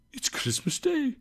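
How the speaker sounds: soft, high, wondering murmur; to himself. A narrator's reading. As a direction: say it softly to yourself in wonder. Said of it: "said Scrooge to himself".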